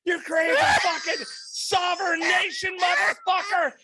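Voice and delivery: high-pitched, full-voiced enraged rant; retold screaming. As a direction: scream abuse as if losing it on the phone. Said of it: "I'm just screaming".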